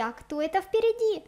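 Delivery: ordinary delivery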